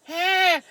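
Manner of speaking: high, loud "ah!"; acted startled cry; retold gasp of alarm. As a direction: yelp as the swan nears. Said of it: "and it's me going".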